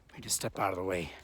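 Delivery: soft unvoiced whisper. Real, not acted. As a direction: whisper so passers-by won't hear. Stepping aside for passing hikers.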